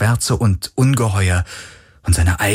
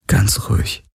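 gentle. Acted. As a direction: soothe a frightened friend. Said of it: "sagte er mit sanfter Stimme".

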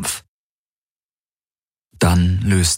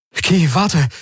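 high, urgent call after someone leaving; 12 semitones higher than usual. Acted. Galen calls after the fleeing Kirai, urgent. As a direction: cry out her name as she runs.

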